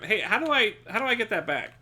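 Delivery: ordinary talk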